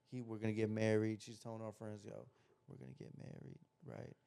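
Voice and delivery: hushed whisper retelling her brag. Retold or imitated. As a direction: whisper it low, like gossip. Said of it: "Why are you whispering?".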